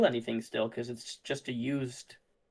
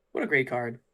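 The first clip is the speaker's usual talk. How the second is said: ordinary delivery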